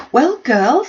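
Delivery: high, loud, gushing matron calling her daughters; a proud mother bursting to share the news; loud, high-pitched delighted greeting; excited Mrs Bennet. Acted. Mrs Bennet voiced by narrator.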